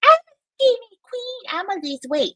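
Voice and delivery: high mock diva voice; mocking Shani. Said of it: "She's like".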